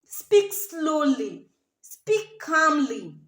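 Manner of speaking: very slow, even instruction; slow calm speech. Shown as a demonstration. Showing brides how to speak slowly. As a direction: spell out each word slowly. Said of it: "demonstrates slow calm speech".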